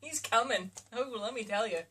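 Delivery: usual speaking voice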